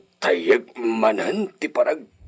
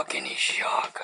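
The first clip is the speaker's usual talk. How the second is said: fading whisper; 10 dB quieter than usual. Acted. Sick man trails off.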